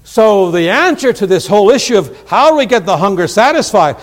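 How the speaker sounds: loud, high, ringing scripture proclamation; full-force preaching. Real, not acted. Preacher crying out Jesus' words to the congregation, full force. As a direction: preach it out to the back pews.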